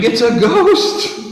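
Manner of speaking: high, slow, loud scream of fright; panicked disciples screaming at the sea; terrified scream. Retold or imitated. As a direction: shriek it like you've seen a ghost. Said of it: "start screaming".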